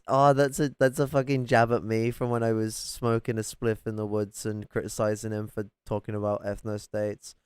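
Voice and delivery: usual speaking voice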